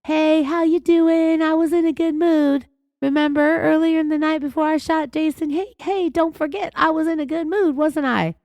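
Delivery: mock her fake, high-pitched friendliness; high, put-on sweet reminder; high-pitchy friendly voice. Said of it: "high-pitchy, friendly voice".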